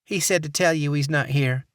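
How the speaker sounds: sugary Southern receptionist; Southern accent. Acted. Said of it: "saccharine Southern accent".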